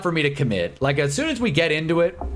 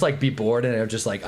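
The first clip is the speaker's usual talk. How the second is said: ordinary talk, nothing marked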